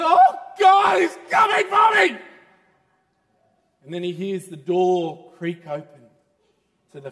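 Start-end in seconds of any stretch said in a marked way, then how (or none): 0.0-2.2 high, loud, fearful shout; terrified cry. Acted. Man huddled in bed, terrified of the devil, shouting. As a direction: cry out in dread under the covers. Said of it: "He said".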